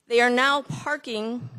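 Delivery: loud and slow, projected; teacher voice. Real, not acted. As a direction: project it to the back of the room. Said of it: "Using my teacher voice instead".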